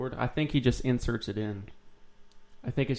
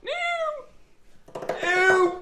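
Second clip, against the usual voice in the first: mock disgust.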